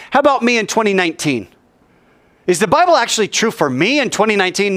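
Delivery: high, urgent voice of a doubter; animated preacher arguing the sceptic's side; impassioned preaching. Acted. Voices a doubter with full force.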